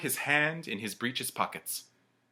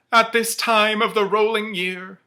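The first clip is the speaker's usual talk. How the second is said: remorseful ghost; 10 semitones higher, 10 dB louder than usual. Acted. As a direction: lament your own wasted life. Ghost speaks.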